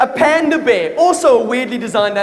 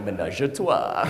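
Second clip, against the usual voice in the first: French-sounding words; 10 semitones lower, 7 dB quieter, slower than usual. Shown as a demonstration. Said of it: "kind of sound French".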